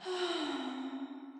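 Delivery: long, soft, put-upon sigh; weary sigh. Acted. Ariel reluctant, given more work.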